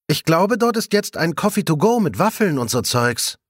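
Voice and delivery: a lightly voiced, lively young colleague; young colleague Svenja. A narrator's reading. (munter plaudernde junge Kollegin)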